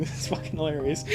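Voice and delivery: ordinary talk, nothing marked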